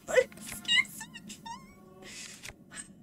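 tiny, very high, slow whimper; mock delight collapsing into a squeak; fake-cheerful squeal. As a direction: squeeze it out softly in a squeaky near-cry. Falsely cheerful collapse while losing.

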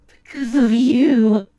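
slow, loud furious blame; roaring accusation; furious shout. Acted. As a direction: hurl the blame at them. Blaming someone in anger.

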